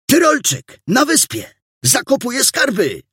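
very high, urgent calling out; alarmed cry. A narrator's reading. Boy brings news of the suspect on the island, alarmed. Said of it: "zawołał alarmującym głosem".

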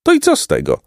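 plain reading voice

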